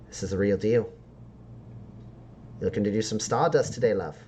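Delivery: lightly voiced dealer tempting a buyer; enticing street dealer. Dealer showing stardust to a customer, lightly voiced enticement. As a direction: tempt them with the special stock.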